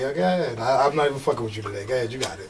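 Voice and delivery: resigned aside. Retold or imitated. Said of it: "shake your head and laugh and be like".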